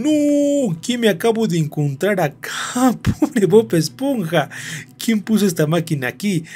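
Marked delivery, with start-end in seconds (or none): 0.0-4.5 high, drawn-out cry of pity; high wail of disbelief, stretching the words; shocked dismay. Real, not acted. Gamer to viewers on finding poor SpongeBob trapped, shocked dismay.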